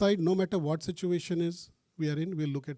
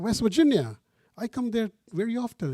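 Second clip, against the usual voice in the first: West Virginia? pitched much higher and louder in delighted surprise; surprised; 7 semitones higher, 4 dB louder than usual. Retold or imitated. To the phone agent from West Virginia, higher, louder surprise. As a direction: react like you can't believe he's from there. Surprised at caller's home state.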